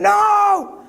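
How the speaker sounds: bursting out at the screen in protest; yelling. Retold or imitated. Teacher recalls yelling at the movie, real shout. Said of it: "I started yelling".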